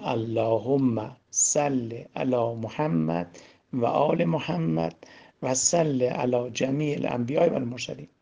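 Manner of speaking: drawn-out, measured salawat; slow devotional recitation. Shown as a demonstration. Teacher guides listeners with eyes shut, slow devotional recitation. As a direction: lead the blessing slowly, lingering on each phrase. Leading a prayer with eyes closed.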